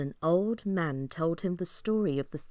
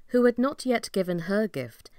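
usual speaking voice

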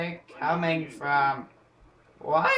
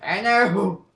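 loud; 6 semitones higher, 10 dB louder than usual. Real, not acted. After teammate says all in trouble.

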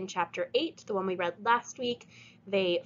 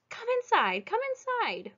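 put-on sweet old lady voice; 14 semitones higher than usual. Mrs Watson invites them in.

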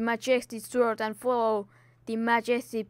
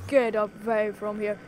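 ordinary talk, nothing marked

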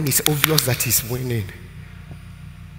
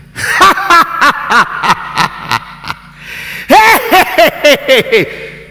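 loud, long staccato belly laugh rising high; mocking laughter; 14 semitones higher, 13 dB louder than usual. Preacher acting a sick man laughing at the devil, mocking laughter. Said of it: "Why are you laughing?".

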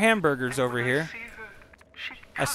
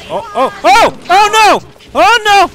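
alarmed shout; 12 semitones higher, 8 dB louder, much slower than usual. Real, not acted. Game goes wrong suddenly.

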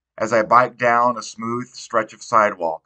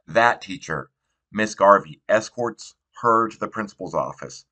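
ordinary delivery, nothing marked

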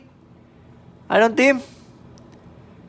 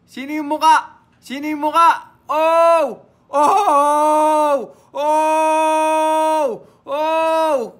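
higher, stifled excited oh's; restrained excited cries; 4 semitones higher, much slower than usual. Real, not acted. Card breaker reacting to the card, stifled cries. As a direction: cry out but keep the voice from cracking. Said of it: "Pinipigilan kong pumiyok".

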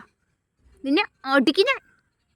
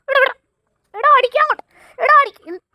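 higher, louder broken-off yells; scolding shout; 9 semitones higher, 4 dB louder than usual. Real, not acted. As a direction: shout while wrestling the stick back. Struggling with the dog.

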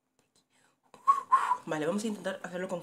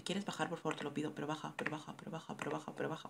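quiet pleading; 8 dB quieter, faster than usual. Real, not acted. Pleading with game character.

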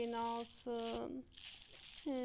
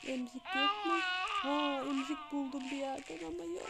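higher, louder pretend baby cries; baby wailing; 4 semitones higher, 6 dB louder than usual. Baby calling the nurse in the dark, loud wailing. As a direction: wail like a lost toddler calling for help.

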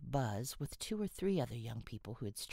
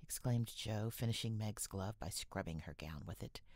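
usual speaking voice

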